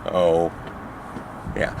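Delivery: talking around a mouthguard; words muffled by a mouthguard; wearing a mouthguard. Real, not acted. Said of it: "I still have my mouth guard in".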